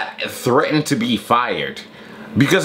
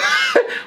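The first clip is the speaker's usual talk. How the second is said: laugh. Real, not acted. Laughing mid rant.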